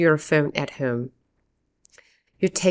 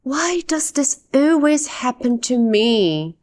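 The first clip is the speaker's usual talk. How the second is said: dramatic frustration; 5 semitones higher than usual. Acted. Teacher acts out losing a parking spot, in shocked frustration. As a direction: let it out, stretching ALWAYS. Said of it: "You sit there in shock and say".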